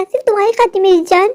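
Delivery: ordinary talk, nothing marked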